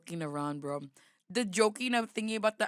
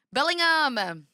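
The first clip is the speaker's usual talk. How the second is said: "Bellingham!" shouted higher and louder with a falling end; loud exclaim; 6 semitones higher, 7 dB louder, much slower than usual. Shown as a demonstration. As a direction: belt out "Bellingham!" like a stadium chant. Repeats the name, testing his accent.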